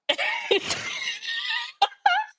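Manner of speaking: high garbled underwater yell; Harry drowning mid-spell, gurgling; underwater gargling shriek. Retold or imitated. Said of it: "Let me try that one more time".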